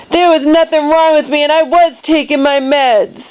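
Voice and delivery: high-pitched protesting denial; mock-indignant wail of protest; indignant mother. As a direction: imitate a mom defending herself, pitched right up. Voices mom indignantly denying it.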